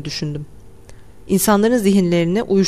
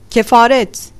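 raised, louder one-word chant; crowd shouting; 4 semitones higher, 5 dB louder, slower than usual. A narrator's reading. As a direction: cry it out as a whole crowd would. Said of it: "diye bağırdılar". (hep birden bağırırcasına)

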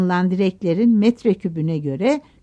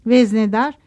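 plain reading voice

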